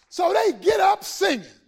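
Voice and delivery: high, loud exclamation; preaching shout. Real, not acted. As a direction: lift the congregation with it.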